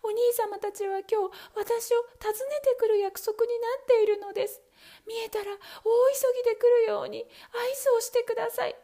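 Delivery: put-on high, bright-pitched young wife, urgent and quick; a frightened young wife pleading her sister; high young wife asking for a signal; young wife's voice.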